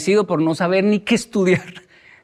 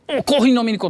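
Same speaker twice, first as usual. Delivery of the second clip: high-pitched, eager greeting; imitated exuberant teacher; 7 semitones higher, faster than usual. Teacher inviting his former pupil for coffee, exuberant. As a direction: call out like an effusive teacher.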